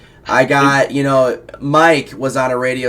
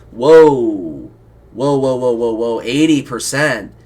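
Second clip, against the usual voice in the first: imitating shocked promoters; slower than usual. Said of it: "their promoters are like".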